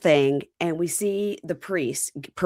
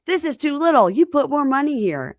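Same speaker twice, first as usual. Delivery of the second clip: high, loud insistence; hammed-up commanding voice; imitated priest's demand; 8 semitones higher, 5 dB louder than usual. Voices priest demanding more money.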